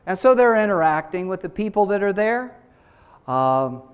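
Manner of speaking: normal talking voice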